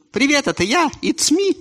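high cheerful returnee; chirpy high visitor; emigrant voice. Acted.

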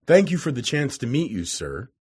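usual speaking voice

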